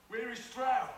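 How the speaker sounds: sharp, high, loud demand; calling out sharply for the missing leader; alarmed demand. Acted.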